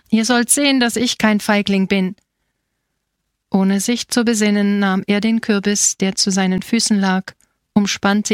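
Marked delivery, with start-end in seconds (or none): none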